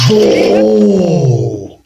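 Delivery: very slow, drawn-out "وای"; long stretched wail. Real, not acted. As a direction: draw it out long in shock. Reaction to clip.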